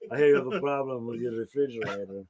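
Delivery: lightly voiced repairman variation; porn repairman. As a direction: play a cheesy adult-film handyman. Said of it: "There's several variations".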